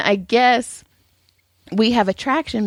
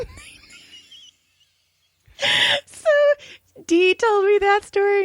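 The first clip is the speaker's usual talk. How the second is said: ordinary talk, nothing marked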